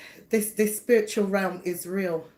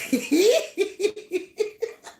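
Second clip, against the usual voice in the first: high laugh; 11 semitones higher than usual. Real, not acted. Laughs at a viewer's comment.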